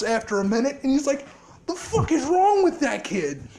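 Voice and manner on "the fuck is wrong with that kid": higher, fast, indignant outburst; angry. Retold or imitated. Izuku fuming to All Might after the fight, an indignant higher outburst. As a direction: spit it out like he can't believe it.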